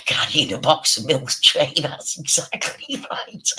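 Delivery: talking through laughter; real laughing. Real, not acted. Picturing Frank carrying Milk Tray, real laughing. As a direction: let giggles break up the words. Laughs at a joke about chocolates.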